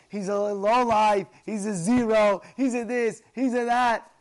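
loud, hammered list of insults; acted denunciation. Gabbais condemn the approaching man, vehement.

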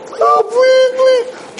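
very high, begging falsetto; pleading squeaky suit. Acted. The stained suit begging its owner, in a high squeaky plea. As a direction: plead like a tiny desperate thing. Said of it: "the suit's like".

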